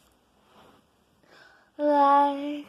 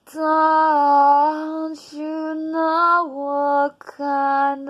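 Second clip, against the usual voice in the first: louder, slowed and declaimed, pushing hard; loud build; 7 dB louder than usual. Acted. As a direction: hurl it at the audience as a climax. Poem builds to climax.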